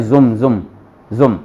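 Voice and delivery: जुम with a voiced z sound; wrong buzzing z. Shown as a demonstration. Teacher imitating students' common mistake. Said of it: "ये जुम नहीं है".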